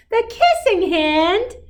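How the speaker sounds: put-on high, slow little-boy question; wide-eyed kid asking what it means; young raccoon puzzled and curious; curious child. Child Chester asks curiously.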